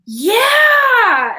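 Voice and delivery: high, loud, drawn-out yeah; joyful retold shout; excited cheer. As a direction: imitate a thrilled little girl. Little girl recognized, retold cheer.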